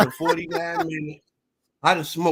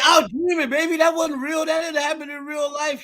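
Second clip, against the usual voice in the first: mock pleading husband; 8 semitones higher than usual. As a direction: beg her to believe it was only a dream. S1 voices husband pleading to wife.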